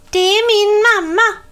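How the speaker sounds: put-on high, loud and proud little girl; proud little witch. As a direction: show off her mother, high and proud. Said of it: "förklarade lilla Celia stolt".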